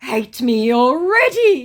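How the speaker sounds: high, loud, slow put-on scammer voice; mock scammer voice. Scammer teasing the angry narrator, high loud mock voice. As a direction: tease him, stretched out. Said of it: "he said".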